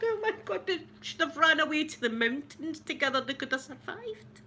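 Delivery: high, breathless wishful gush; dramatic wish. Retold or imitated. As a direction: gush it like a heartbroken fan. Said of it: "I thought".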